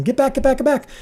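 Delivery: high urgent retold yelling; calling out. Crew calling from their cars to come back, urgent high call.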